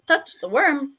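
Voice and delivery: high, loud sing-song mimicry; teasing echo. Game master teasing a player about touching the worm, high playful mimicry.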